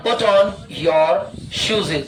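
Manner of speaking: very slow, each word drawn out for students; slow model sentence. Shown as a demonstration. Teacher to pupils, modelling the sentence very slowly. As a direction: spell the sentence out slowly so the class can repeat.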